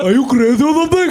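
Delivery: mocking a friend's line, laughing; impression of Ronak. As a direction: toss it off as your friend would. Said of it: "that sounded exactly like Ronak".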